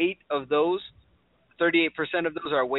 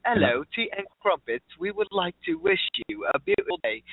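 lightly put-on British accent, a touch higher; mock British accent; 4 semitones higher than usual. Host mimics British coworker winning commercials, light mock British accent. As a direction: poke fun with a sing-song British accent. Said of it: "She has this British accent".

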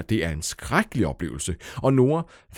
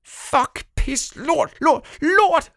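high, loud furious scream; 6 dB louder, much slower than usual. Acted. Nora screams the swear words out in rage. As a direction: scream it out in frustration. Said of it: "skreg Nora".

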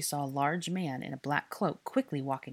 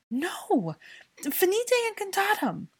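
high, loud, panicked spell cry; desperate cry; 6 dB louder, slower than usual. A narrator's reading. Hermione failing to free Draco's hand, desperate high cry. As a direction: cry out desperately to break the curse.

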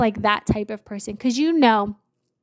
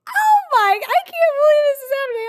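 very high, louder, fast giddy squeal; excited disbelief; 5 dB louder, much faster than usual. Retold or imitated. Bethany overjoyed with the nurse, high giddy squeal. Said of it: "I'm, like, laughing".

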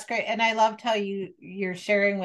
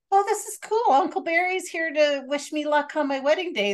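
bright, much higher voice, thrilled; light up with sudden joy; delighted; 8 semitones higher than usual. Retold or imitated. To her daughters in the car, an excited high voice. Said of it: "and I was like".

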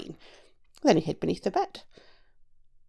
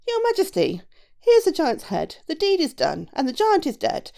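high, raised, proclaiming character voice; proud shoemaker; 7 semitones higher, 5 dB louder than usual. A narrator's reading. Shoemaker presenting the giant's head to the king, proudly raised voice. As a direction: announce the deed to royalty.

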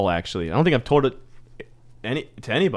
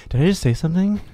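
nervous self-conscious quote; 7 semitones higher than usual. Retold or imitated. Asking friends mid-awkwardness.

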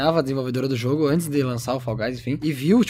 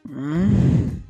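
an "A" hummed through closed lips; talking with mouth closed. Shown as a demonstration. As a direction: say "Hum" as an A without opening the mouth. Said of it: "fala aí". (falando A com a boca fechada)

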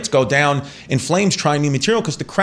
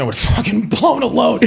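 excited shout; 9 semitones higher than usual. Real, not acted. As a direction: blurt it out in mock outrage. Joking outburst to cohost.

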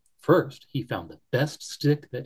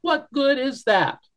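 mild sniffly bunny; 14 semitones higher, 5 dB louder than usual. Acted. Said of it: "Barrington sniffled".

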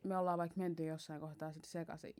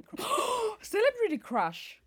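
gasped, higher and slow; excited; 6 dB louder, slower than usual. Real, not acted. Reacting to the game topic, excited. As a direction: gasp and exclaim the topic.

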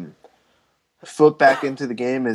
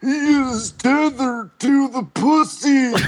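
very high, strained, drawn-out creature voice; hammed-up horror double, slow and high; tethered voice; 11 semitones higher, much slower than usual. Joking about being tethered like in Us, hammed-up tethered voice.

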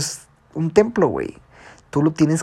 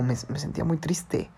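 whispered confession, barely voiced; hushed whisper of a painful memory; 7 dB quieter than usual. Real, not acted. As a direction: breathe it out as a secret. Confessing past suicide attempt.

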